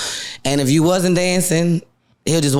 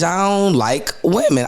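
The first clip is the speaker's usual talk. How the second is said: usual speaking voice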